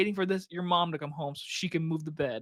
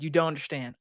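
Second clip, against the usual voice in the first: ordinary talk, nothing marked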